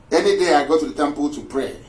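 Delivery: normal talking voice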